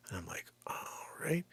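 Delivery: hushed, breathy confession; whisper. Real, not acted. Realtor confiding a horror story to viewers, whispered. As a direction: lean in and whisper it.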